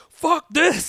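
loud, high panicked yell; shout. Retold or imitated. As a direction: yell it as you bolt. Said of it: "I was like".

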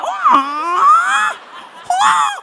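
very high, loud kungfu wail; hammed-up Bruce Lee war cry. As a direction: screech like a kungfu master. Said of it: "Kalau kungfu apalagi".

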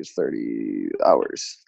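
drawn out and low; slow counting. Real, not acted. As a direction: count it out slowly. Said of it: "well, let's see".

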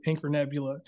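ordinary talk

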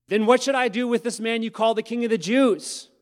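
high, loud pleading question; loud dramatic reading. Acted. Pilate asks the crowd, loud and high. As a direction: Pilate shouting over the crowd.

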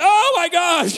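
hammed-up horrified cry, high and loud; comic shriek of alarm; mock panic. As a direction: wail it in exaggerated terror. Husband panics at possessed wife.

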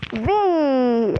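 long swooping playful cry; gleeful whoop. A narrator's reading. Peeves aiming another water bomb, gleeful drawn-out whoop. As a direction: whoop as if lobbing something for fun.

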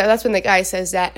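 ordinary talk, nothing marked

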